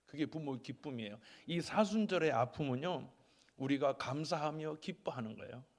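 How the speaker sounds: normal talking voice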